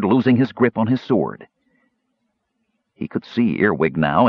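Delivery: usual speaking voice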